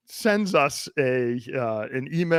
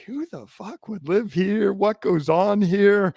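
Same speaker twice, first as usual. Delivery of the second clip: higher, slow incredulous sneer; mocking outsider; 6 semitones higher, slower than usual. Podcast host mocking the town he visited, slow and sneering. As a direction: scoff at the town like a rude tourist. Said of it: "being like".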